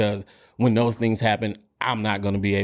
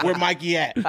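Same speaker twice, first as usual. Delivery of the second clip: laughing tough guy; 7 semitones higher than usual. Retold or imitated. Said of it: "He's like".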